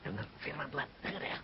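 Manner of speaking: very soft bedside whisper. Acted. Doctor to coma patient at bedside, whispering. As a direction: whisper it gently into a patient's ear. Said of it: "tempat tidur dibisiki".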